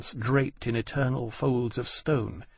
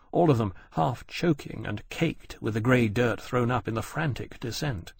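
usual speaking voice

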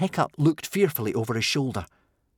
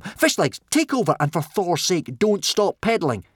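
urgent order; 7 semitones higher, 4 dB louder than usual. A narrator's reading.

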